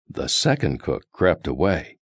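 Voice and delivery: plain reading voice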